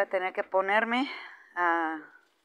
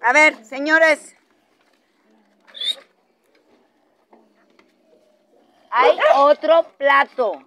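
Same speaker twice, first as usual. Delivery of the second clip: stern scolding; 2 semitones higher, 7 dB louder than usual. Real, not acted. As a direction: scold them loudly, stressing each phrase. Scolding dogs fighting over plate.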